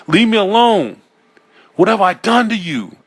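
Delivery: high, loud, pleading cry; desperate yell. A narrator's reading. Man shouting at eyes in the mirror, desperate. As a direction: scream it out, begging them to stop.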